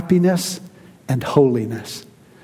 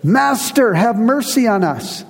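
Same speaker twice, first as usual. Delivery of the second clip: lepers' plea called out in a higher voice; high, pleading call from a distance, mildly performed; crying out; 5 semitones higher than usual. Acted. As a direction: read it as a raised cry for mercy. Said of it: "lifted up their voices, and cried out".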